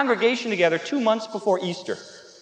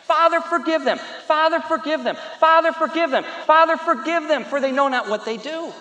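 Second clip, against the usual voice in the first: raised, insistent prayer repeated over and over; repeated pleading prayer; 6 semitones higher, 4 dB louder than usual. Retold or imitated. Preacher voices Jesus praying on the cross, insistent plea. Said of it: "he kept saying, he kept praying".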